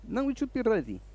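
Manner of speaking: high-pitched Eastern European tech guy; Eastern European accent. Retold or imitated. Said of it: "I gave him that accent".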